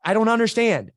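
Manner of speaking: loud, high, exasperated bewilderment; baffled. Retold or imitated. Client giving up on coach's question, baffled. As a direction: throw up a loud, high protest.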